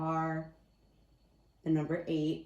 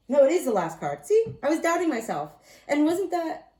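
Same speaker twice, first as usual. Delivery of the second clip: higher, louder burst of vindication; excited realization; 8 semitones higher, 8 dB louder than usual. Real, not acted. Reader finds it is the last card, excited and louder. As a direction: pop up with sudden relief at being right. Realises she was right.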